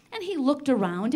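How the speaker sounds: usual speaking voice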